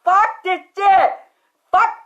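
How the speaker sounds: loud, slow, enraged shout; raging shout while charging at her; furious yell. Retold or imitated. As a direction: explode in rage. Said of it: "I said".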